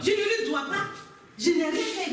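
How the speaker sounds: high-pitched, heated self-defence; indignant protest. Acted. Wife protests her innocence to officers.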